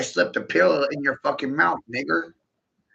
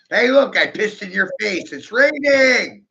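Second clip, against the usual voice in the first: gleeful mock announcement; 7 semitones higher, 5 dB louder than usual. Mock announcing the piss joke.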